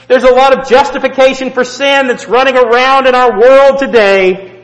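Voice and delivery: loud, high preaching to the congregation; fiery preaching. Acted. Preacher warning the church about worldly philosophy, fiery. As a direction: drive it home from the pulpit.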